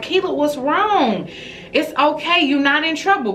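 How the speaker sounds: high-pitched, soothing reassurance; retold motherly concern. Mother retelling how she consoled her crying daughter, concerned.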